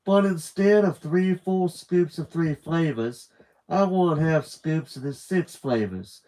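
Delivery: put-on Southern drawl and British accent. The fussy perfumed woman ordering at the counter, Southern-British accent. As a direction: order sundae toppings in a half Southern drawl, half upper crust British. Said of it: "A Southern drawl and a British accent".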